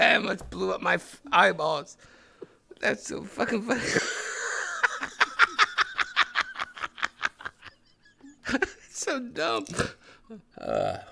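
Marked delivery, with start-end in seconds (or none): 3.9-7.5 long high laugh, fading down; a long, high laugh that fades away. Real, not acted. Laughing at his own joke.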